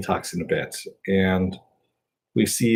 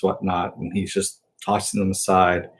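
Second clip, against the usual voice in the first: ordinary delivery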